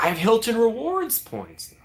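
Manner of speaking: slow, high-pitched boastful protest; hammed-up high voice, stretched out; imitating a friend. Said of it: "She's like".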